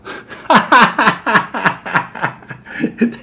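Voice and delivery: big high burst of laughter. Real, not acted. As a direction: crack up at the joke. Host laughs at punchline.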